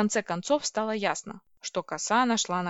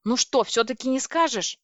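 menacing; 5 semitones higher, 6 dB louder than usual. A narrator's reading. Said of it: "и я грозно спросила".

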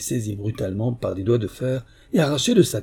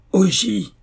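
furious shout, much higher and louder; 9 semitones higher, 6 dB louder than usual. A narrator's reading. Samurai loses patience with the silent blind man, furious shout. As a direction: explode with rage on the name. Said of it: "d'un accent furieux".

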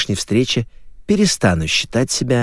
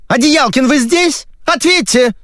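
very high-pitched squeal of demand; shrieking; 3 dB louder than usual. Acted. TV woman demanding an answer in his ear, shrieking. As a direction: shriek it down the phone. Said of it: "Взвизгнули в правом ухе с такой силой".

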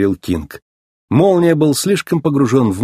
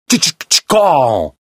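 showy catchphrase; 3 semitones higher, slower than usual. A narrator's reading. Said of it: "выкрикивал".

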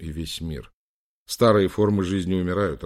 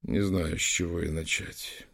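softly plead, breath taking over at the end; whispered; 5 dB quieter than usual. A narrator's reading. Prince asks his beloved for forgiveness, whispering. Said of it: "прошептал он".

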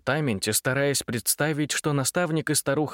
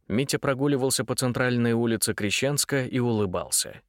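ordinary delivery

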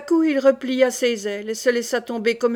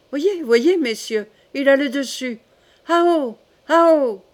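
higher, louder triumphant calls; excited cry; 3 semitones higher, 5 dB louder, slower than usual. Acted. Charles showing the courtiers his falcon on top, crying excitedly. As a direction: call everyone to look, then halloo the bird. King cheers falcon's winning attack.

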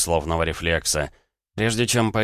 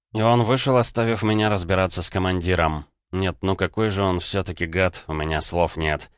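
ordinary delivery

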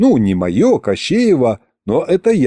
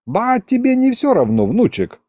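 lightly voiced grandpa, pitched high; old grandpa; 10 semitones higher than usual. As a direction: chide the grandson as an old man. (дедушкин говорок)

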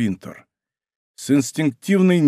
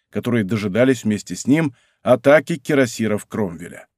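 plain reading voice

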